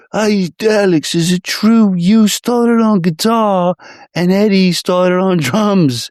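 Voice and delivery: a hammed-up dopey interviewer voice. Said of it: "he says".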